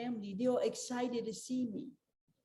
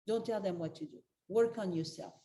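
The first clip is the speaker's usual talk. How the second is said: usual speaking voice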